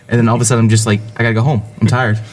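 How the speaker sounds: ordinary talk, nothing marked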